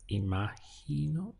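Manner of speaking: imagino said slowly and pitched well up, rising; imagino, slow and lifted, like a teacher modelling; slow, high demo word. Shown as a demonstration. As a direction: stretch imagino out high for the class. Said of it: "this goes to O".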